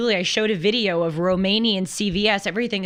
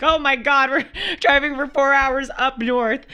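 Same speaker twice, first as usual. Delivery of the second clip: high, half-crying mock panic; 5 semitones higher, 3 dB louder than usual. Retelling the scary taxi ride, high mock panic. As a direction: whimper as if trapped in the car. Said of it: "And I was like".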